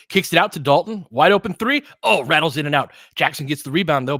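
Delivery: play-by-play voice surging on a wide-open three; excited call. Real, not acted. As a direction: build up to the shot, then groan at the miss.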